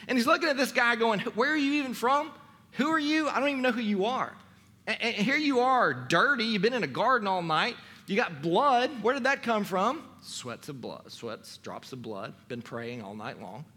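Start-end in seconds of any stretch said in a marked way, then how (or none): 1.2-10.0 lightly acted disbelieving interrogation, pitched high; scornful Pilate. Pilate sneering at bound prisoner. 10.2-13.7 low, soft aside; quiet aside. Acted. Muttered aside within Pilate's speech.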